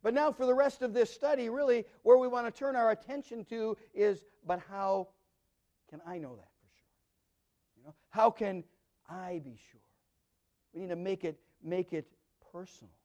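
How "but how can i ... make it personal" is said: a soft, intimate near-hush; hushed question. Real, not acted. Pastor turning the question to each listener, hushed. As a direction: lean in and ask it gently. Preacher asks the personal question.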